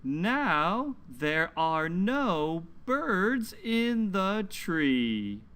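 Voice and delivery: slow, lilting picture-book reading; reading to children. Acted. Teacher reading a story aloud to children, slow and lilting. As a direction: read it slowly to little listeners, leaning on the numbers.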